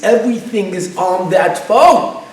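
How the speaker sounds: a passenger begging his partner, panicking over his phone. Retold or imitated. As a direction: plead in rising panic. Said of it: "And he's panicking".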